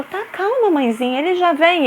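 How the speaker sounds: high, syrupy brush-off; mock-sweet dismissive carer; mocking sweet staff voice. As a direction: imitate a condescending daycare worker soothing mum. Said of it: "ela continuou".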